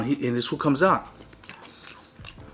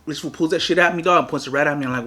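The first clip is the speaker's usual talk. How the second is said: usual speaking voice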